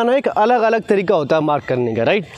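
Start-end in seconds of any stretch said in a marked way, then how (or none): none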